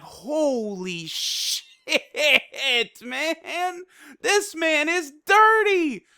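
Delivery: real shocked laugh, pitched high; stunned disbelief. Real, not acted. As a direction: gasp it out in laughing disbelief, slow. Reacting to shocking kill scene.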